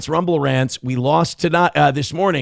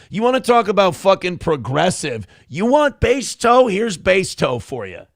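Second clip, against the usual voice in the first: usual speaking voice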